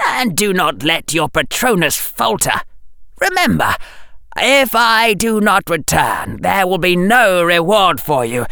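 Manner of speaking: high, cold threat; high icy villain voice; menacing Dark Lord. A narrator's reading. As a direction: chill threat to a servant.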